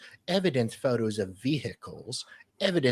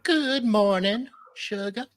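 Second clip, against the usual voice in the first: mock sweet southern lady; 7 semitones higher than usual. Reading Rachel's email aloud as a sugary southern lady.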